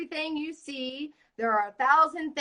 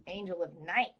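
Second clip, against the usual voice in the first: ordinary talk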